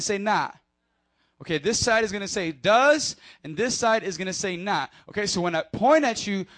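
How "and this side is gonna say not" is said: ordinary talk, nothing marked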